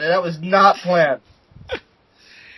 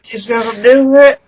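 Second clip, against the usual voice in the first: higher, louder put-on announcer voice; Levi voice; 6 semitones higher, 6 dB louder than usual. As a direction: hype it up in a silly voice. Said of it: "were we doing his voice".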